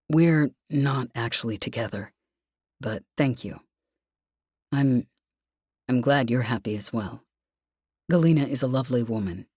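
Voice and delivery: low, sorry, trailing off; Lev, apologetic. Acted.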